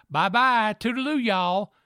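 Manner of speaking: a cheery on-air farewell, Southern style; radio sign-off. Retold or imitated. Morning radio host signing off to listeners, cheery Southern goodbye. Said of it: "I would always say".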